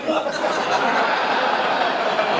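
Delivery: high burst of giddy laughter; thrilled cackle at the memory; delighted laugh. Real, not acted. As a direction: laugh out loud in disbelief. Overjoyed at Mad offices.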